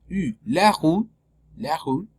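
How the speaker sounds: "rue" with a closed French U. Shown as a demonstration. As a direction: demonstrate the closed "U", then "la rue". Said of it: "es una U cerrada".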